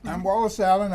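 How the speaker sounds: ordinary talk, nothing marked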